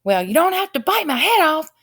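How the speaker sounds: high, loud frightened scream; terrified scream. A narrator's reading. Terrified earl screaming at the others. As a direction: shriek it in panic. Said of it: "screamed the terrified earl".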